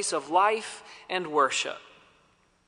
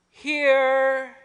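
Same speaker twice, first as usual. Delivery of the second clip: calling back; 6 semitones higher than usual. Retold or imitated. Answer from fog.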